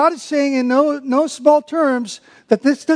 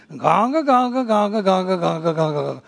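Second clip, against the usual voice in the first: ganga mutter sliding lower; grumbling noise; 4 semitones lower, 3 dB quieter than usual. Shown as a demonstration. Preacher imitates complainers, low grumbling mutter. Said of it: "a word that sounds like what it is".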